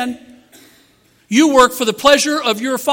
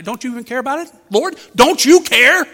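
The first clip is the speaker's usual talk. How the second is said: indignant plea; 3 semitones higher, 6 dB louder than usual. Retold or imitated. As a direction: demand that he care. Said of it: "And she said".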